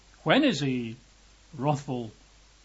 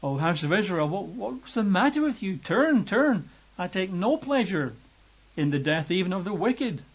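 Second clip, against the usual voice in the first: urgent, higher-pitched appeal; pleading; 4 semitones higher than usual. Acted. God begging his people to turn back, pleading. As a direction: plead with them, pitch lifting on each appeal. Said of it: "pleading to his people".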